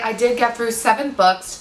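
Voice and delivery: ordinary talk, nothing marked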